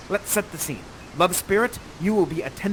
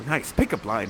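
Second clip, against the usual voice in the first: ordinary talk, nothing marked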